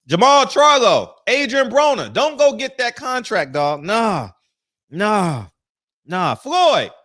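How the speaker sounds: loud, high call-out to the fighters. Acted. Host loudly warning fighters not to take the contract. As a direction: shout at them not to sign.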